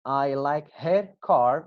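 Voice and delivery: slow, with "her" said in full; slow careful reading. Shown as a demonstration. Teacher reading the example slowly to students. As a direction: spell out each word slowly. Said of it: "I'll read the examples one more time".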